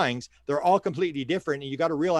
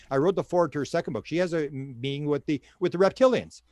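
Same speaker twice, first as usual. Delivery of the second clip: ordinary talk, nothing marked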